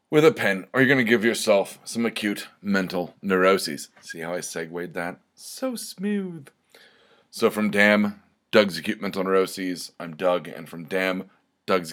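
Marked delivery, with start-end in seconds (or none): 5.4-6.5 much higher, softer, mock self-satisfied; smug falsetto. Host praising his own segue to listeners, mock smug falsetto. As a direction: purr it like you're proud of a cheesy segue.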